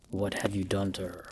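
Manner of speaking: low, soft, tense hiss. A narrator's reading. Captive demands news of the girlfriend, hissed low. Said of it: "I hissed".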